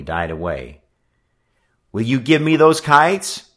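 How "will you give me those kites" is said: loud, raised-pitch challenge; forceful, insistent question; determined demand. A narrator's reading. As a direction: demand them back squarely. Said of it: "Joe demanded".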